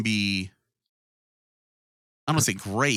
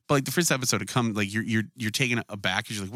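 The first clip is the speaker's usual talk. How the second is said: normal talking voice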